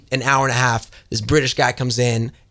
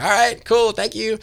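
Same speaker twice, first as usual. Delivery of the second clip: high-pitched, agreeable sign-off; agreeable teen; 9 semitones higher, slower than usual. Retold or imitated. Seventeen-year-old sent home after the shoot, agreeable. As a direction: pitch it up, politely compliant. Acting his teenage self being dismissed.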